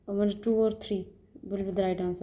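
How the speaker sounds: ordinary delivery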